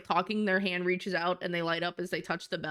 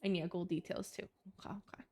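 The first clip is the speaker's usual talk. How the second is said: whispering; 3 semitones lower, 8 dB quieter than usual. Real, not acted. Quiet aside while drawing.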